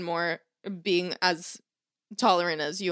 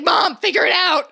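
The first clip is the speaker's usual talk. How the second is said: mock-exasperated, higher and louder outburst; 7 semitones higher, 5 dB louder than usual. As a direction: lose patience with mom, half laughing. Acts out snapping at her mom.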